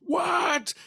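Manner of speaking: high, loud disbelieving what; high shouted what; shocked shout. Retold or imitated. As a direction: yelp in disbelief. Driver offered whiskey bottle.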